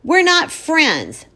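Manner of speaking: high, slow defensive insistence. Acted. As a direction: defend yourself hard. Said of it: "I defend myself".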